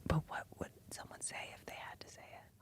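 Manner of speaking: barely audible whisper; faint, hushed ASMR question. Pretend. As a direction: whisper very softly into the mic. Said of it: "do a little E S M R".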